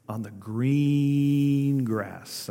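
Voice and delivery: "green" held very long; stress on green. Real, not acted. Preacher reading the verse to the congregation, "green" highlighted by stretching it. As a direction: draw out "green" slowly before "grass". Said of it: "I highlight green".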